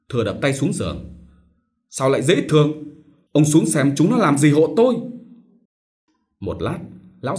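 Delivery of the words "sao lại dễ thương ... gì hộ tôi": louder, sharp demand; irritated order. A narrator's reading. Sick master orders old servant, annoyed and loud. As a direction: snap at the servant.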